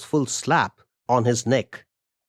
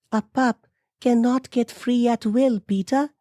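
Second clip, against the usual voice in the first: very high-pitched female character voice; high-voiced wife disagreeing; protesting wife; 12 semitones higher than usual. A narrator's reading. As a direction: argue in a high, put-on woman's voice. Amelia argues with husband.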